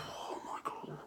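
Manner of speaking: whispered in disbelief; appalled whisper. Real, not acted. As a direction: breathe it out, appalled. Reacting to whole school dabbing.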